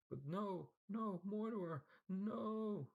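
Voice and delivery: very high, rising panicked cries; terrified pleading. A narrator's reading. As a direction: scream for mercy. Servant begs before death.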